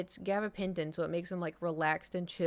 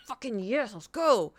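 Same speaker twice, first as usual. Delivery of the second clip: louder, excited relief; relieved cheer; 4 semitones higher, 5 dB louder than usual. Real, not acted. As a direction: punch it out, finally. Allowed into the back room.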